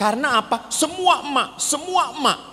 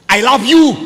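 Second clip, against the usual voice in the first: shouted, higher I love you; loud I love you; 5 semitones higher, 10 dB louder than usual. Retold or imitated. Batak husband says I love you, shouting it loud. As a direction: bellow a love line like an order. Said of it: "ngomong romantis pun keras gitu".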